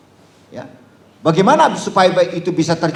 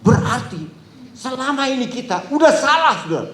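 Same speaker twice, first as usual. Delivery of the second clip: shocked realization; 9 semitones higher than usual. Retold or imitated. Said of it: "katanya, dia ngomongin".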